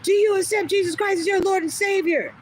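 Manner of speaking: high-pitched mock yell of a zealot; imitated yelling. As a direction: yell it like someone accosting a stranger. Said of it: "just yelled in my face".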